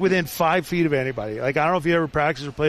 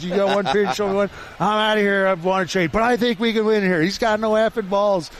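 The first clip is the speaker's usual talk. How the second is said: raised, slowed rant about the coach; mild mock rant; 4 semitones higher than usual. Goalie rants to teammates about the coach, in a mock heated voice. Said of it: "he launches into".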